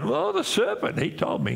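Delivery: hammed-up passing of blame; whiny excuse. Preacher voicing Eve blaming the serpent, high mock whine.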